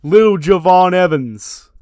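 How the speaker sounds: loud, high, slow sinister taunt; villain gloating. Retold or imitated. Shaquan Sugar taunting Javon on the mic, slow and loud. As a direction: laugh then gloat like a heel.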